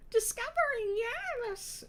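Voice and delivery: brightened, very high character exclamation; Shiraz. A narrator's reading. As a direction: seize on the right word in delighted falsetto. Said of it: "Shiraz brightened".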